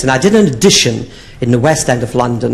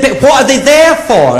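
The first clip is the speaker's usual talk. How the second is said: put-on high, wide-eyed child; little boy voice; 12 semitones higher, 4 dB louder than usual. Nephew asking his aunt, high child voice. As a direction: ask like a curious four-year-old.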